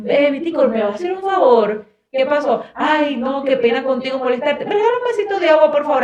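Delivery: agudo y rápido, pedido melindroso; cortesía exagerada burlona. Retold or imitated. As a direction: remeda la cortesía excesiva con voz más aguda. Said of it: "en cambio aquí". (cortesía empalagosa imitada, aguda y rápida)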